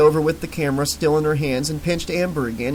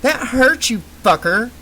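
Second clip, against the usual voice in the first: indignant; 8 semitones higher than usual. Acted. Just pinched, indignant.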